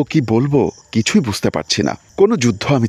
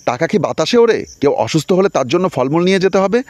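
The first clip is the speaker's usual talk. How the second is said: scolding; 7 semitones higher than usual. A narrator's reading. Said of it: "আমাকে ধমক দিয়ে বলল".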